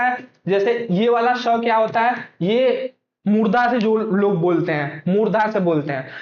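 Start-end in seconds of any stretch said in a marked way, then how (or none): none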